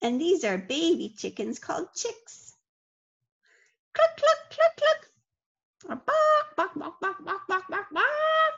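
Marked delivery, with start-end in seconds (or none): 3.9-5.1 high clucking like a hen. Pretend. Chickens shown to children. 5.9-8.5 high, silly mock barking. Joke: chickens barking.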